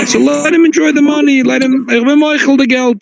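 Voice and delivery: high, fervent forgiving cry; high-pitched plea. Retold or imitated. Rabbi pleading for the poor thief, high and fervent. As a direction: lift the voice high, giving the money away freely.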